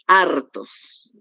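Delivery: "hartos" slowly, long stressed HAR; accent on alpha. Shown as a demonstration. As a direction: draw out HAAR-tos, falling. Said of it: "aquí en la alfa, coincide el acento".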